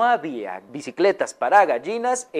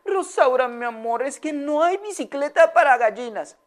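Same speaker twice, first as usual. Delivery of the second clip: high, gentle lady's voice; Señora Amelia; 9 semitones higher than usual. Acted. Owner gently telling her sad hen there's no bike, high voice. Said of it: "le dijo".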